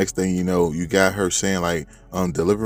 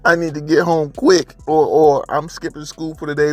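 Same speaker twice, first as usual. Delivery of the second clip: urgent plea; 7 semitones higher, 5 dB louder than usual. Retold or imitated. Urgent imagined call to parents.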